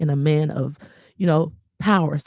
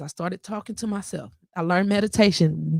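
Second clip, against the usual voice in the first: normal talking voice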